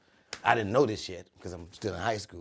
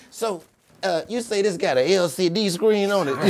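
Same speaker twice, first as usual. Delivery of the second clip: mock curious customer; 11 semitones higher, 13 dB louder than usual. Teen distracting cashier with questions.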